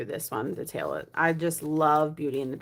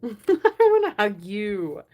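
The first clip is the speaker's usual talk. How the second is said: louder, slow, laughing through it; laughing affection; 3 semitones higher, 5 dB louder, slower than usual. Real, not acted. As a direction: laugh while saying it, touched.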